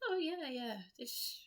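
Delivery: high, breezy imitation of an aristocratic South African accent. Mimicking the South African lady's aristocratic South African accent. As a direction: toss it off in a posh South African accent, as if it's common. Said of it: "aristocratic sounding South African voice accent".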